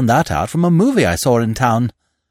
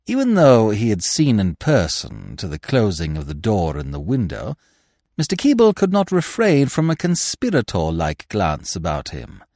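ordinary delivery

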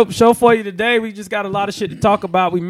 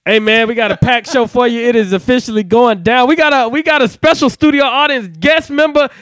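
loud, high hype host; hype show intro; 9 semitones higher, 6 dB louder than usual. Acted. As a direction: pump up the show opener.

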